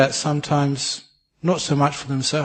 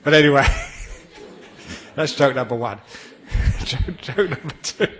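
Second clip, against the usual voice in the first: laughing. Real, not acted. Speaker cracks up at own joke.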